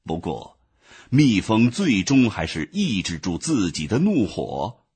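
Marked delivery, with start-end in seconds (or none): none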